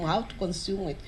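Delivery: ordinary talk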